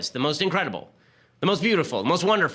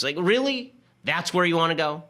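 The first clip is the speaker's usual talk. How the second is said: incredulous; 4 semitones higher than usual. Real, not acted. Incredulous at the left's overreach.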